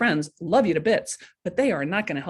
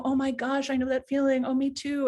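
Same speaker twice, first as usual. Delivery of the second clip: sympathetic friends; 6 semitones higher than usual. Retold or imitated. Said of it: "everybody's gonna go".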